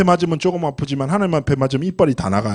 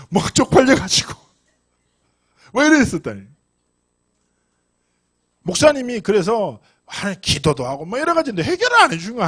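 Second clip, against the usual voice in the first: normal talking voice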